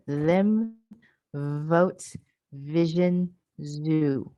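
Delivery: careful, drawn-out voiced consonants; voiced fricatives demo. Shown as a demonstration. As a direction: lean on the buzz in them, vote, vision, zoo. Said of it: "Let me say it again".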